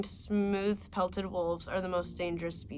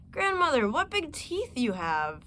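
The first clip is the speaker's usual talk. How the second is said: put-on wondering little girl; 5 semitones higher than usual. Girl's wondering question.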